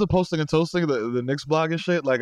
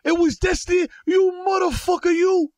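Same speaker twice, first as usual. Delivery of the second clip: put-on angry old Italian fan. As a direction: yell at the speaker like an outraged old Yankees fan. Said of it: "he's yelling at the speaker".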